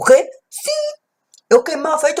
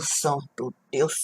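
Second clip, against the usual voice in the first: hushed plea; 5 semitones lower, 10 dB quieter, slower than usual. Acted. Inner prayer inside the lamp.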